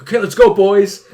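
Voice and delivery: loud, high rallying call; imitated boys catchphrase. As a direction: mimic a guy rallying his mates. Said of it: "he'd always be like".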